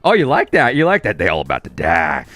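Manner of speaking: a hint of a gloating overlord; taunting like a smug god; omnipotent overlord voice. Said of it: "overlord voice going".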